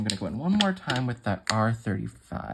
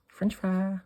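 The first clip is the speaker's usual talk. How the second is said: playful mock French accent; hint of a hammed-up French accent; 6 semitones higher, slower than usual. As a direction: lightly put on a French accent for a cartoon fry. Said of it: "he's got a French accent".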